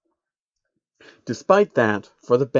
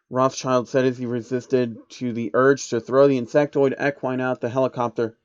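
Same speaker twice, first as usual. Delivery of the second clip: plain reading voice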